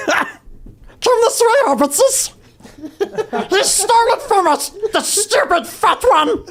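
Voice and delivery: very high, slow Gollum-like croak; cartoon goblin mockery of a politician; Lori Lightfoot. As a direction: stretch it out in a high creature voice. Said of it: "Lori Lightfoot".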